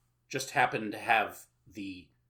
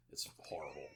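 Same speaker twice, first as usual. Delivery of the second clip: normal talking voice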